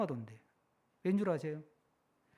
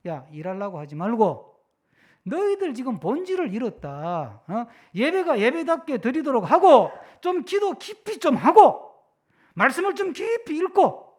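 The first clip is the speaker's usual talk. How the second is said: raised, insistent voice of God; God exhorting His people; 4 semitones higher, 9 dB louder than usual. Acted. God to busy pastors, urgent loud exhortation. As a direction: urge the flock loudly, drawing out key words. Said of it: "하나님은".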